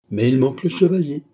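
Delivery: youthful mockery, a touch of laughter in it; mocking young laugh-voice. A narrator's reading. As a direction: tease the old lady with a grin. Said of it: "en riant de ce rire franc et moqueur".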